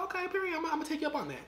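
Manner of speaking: high-pitched, playful going-along voice; perky agreement. Retold or imitated. Agreeing to a friend's dare to flirt, perky and high.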